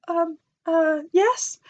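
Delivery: high, timid little answer rising to a squeak; hesitant, caught off guard; shy nervous yes. Retold or imitated. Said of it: "And I was like".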